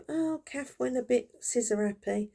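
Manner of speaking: high, rising rueful explaining; sheepish dad. Retold or imitated. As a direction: explain it like a guilty father. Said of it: "And he".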